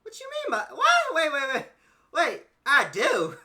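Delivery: high, loud disbelief, slowing at the end; incredulous. Real, not acted. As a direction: react like you can't believe it. Disbelief at guest's answer.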